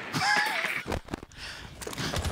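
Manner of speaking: long airless wheezing laugh; breathy silent laugh. Real, not acted. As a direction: laugh without voice, shaking. Laughs at the mailed cake idea.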